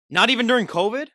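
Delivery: sharp amazed question; surprised. Real, not acted. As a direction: ask it in real surprise. Host surprised she never cries.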